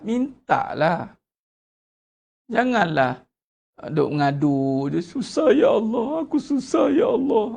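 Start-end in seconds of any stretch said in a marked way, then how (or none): 5.0-7.5 higher, whiny moaning to God; mock whining complaint. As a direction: moan it like a sulking complainer. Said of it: "janganlah duk ngulang".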